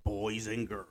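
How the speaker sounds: booming showman announcer intro; ringmaster. Pretend. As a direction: call it like a circus ringmaster. Podcast intro announcer opener.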